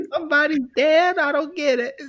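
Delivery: high, confused voice of the wife; baffled wife calling from the kitchen; Carol puzzled. Retold or imitated. Wife in the kitchen asks about the promo, high and puzzled. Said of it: "She's like".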